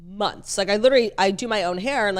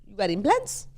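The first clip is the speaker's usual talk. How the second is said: put-on Latin accent. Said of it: "I'm gonna do an accent".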